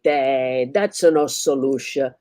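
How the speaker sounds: slow, dismissive Italian shrug; Italian barber brushing it off, slow; Italian accent. Acted. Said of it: "like a loud Italian".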